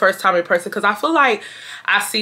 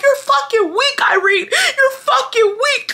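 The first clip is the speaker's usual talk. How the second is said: mock screaming insult. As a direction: scream it at Irene like the crying girl. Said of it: "she's literally calling me weak".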